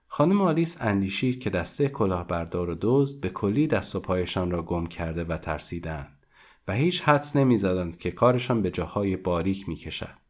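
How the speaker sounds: ordinary delivery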